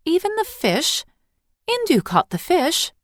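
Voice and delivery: put-on high, indignant child protest; dismayed girl. Eily protesting to her mother about the fish, high dismay. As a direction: squeak it out in disbelief.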